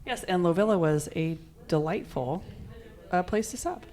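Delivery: normal talking voice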